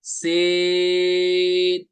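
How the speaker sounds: "सीन" held long, with a hissing whistle; long whistled sin. Shown as a demonstration. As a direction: stretch "सीन" for three counts and let it whistle. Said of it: "साथ में सीटी भी निकालेंगे". ("सीन" को सीटी के साथ तीन अलिफ खींचें)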